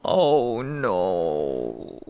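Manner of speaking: low, drawn-out groan; sinking dread. Real, not acted. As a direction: let it sink low and slow in dismay. Dread at only two parts.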